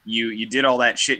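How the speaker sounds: usual speaking voice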